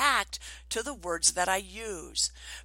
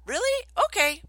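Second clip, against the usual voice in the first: put-on high, loud child's relieved reply; perky, high kid voice; imitated little girl; 11 semitones higher, 6 dB louder than usual. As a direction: mimic a small child suddenly won over. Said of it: "And then she's like".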